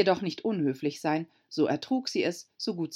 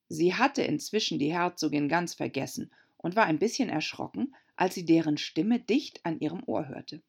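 usual speaking voice

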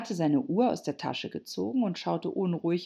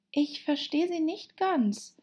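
put-on high, polite girl's voice; 11 semitones higher than usual. Confused Alice to the Hatter, high and polite. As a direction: say it as politely as possible, pitched up. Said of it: "sagte sie so höflich".

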